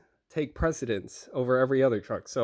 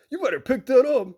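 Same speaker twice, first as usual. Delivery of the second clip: higher, mock-threatening order; imitated irate driver; 8 semitones higher, 4 dB louder than usual. Irate driver warning receiver about paperwork, imitated threat. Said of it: "he gets irate".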